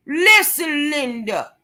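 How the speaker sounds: high, drawn-out "Listen", dropping on "Linda"; mock scolding catchphrase. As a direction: call someone out in exaggerated exasperation. Catchphrase called out in mock rant.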